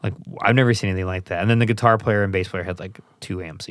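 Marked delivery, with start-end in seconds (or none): none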